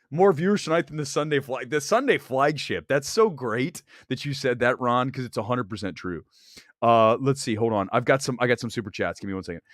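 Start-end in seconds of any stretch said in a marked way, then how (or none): none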